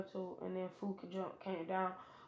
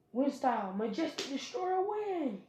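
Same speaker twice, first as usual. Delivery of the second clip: higher, slow, drawn-out jutsu name; dramatic attack call; 6 semitones higher, slower than usual. Acted. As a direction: announce the technique like an anime finisher.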